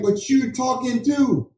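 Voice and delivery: high, drawn-out retort in a retold squabble; mock protest. Husband answering wife's accusation, mock protest. Retold back-and-forth blame between partners.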